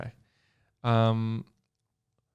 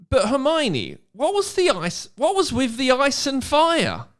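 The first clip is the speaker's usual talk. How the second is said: put-on higher, excited young boy voice; Rob's voice; 11 semitones higher, 4 dB louder than usual. Reading the fan fiction aloud, Rob asks Hermione in a high voice. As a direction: voice Rob asking eagerly. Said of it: "asked Rob".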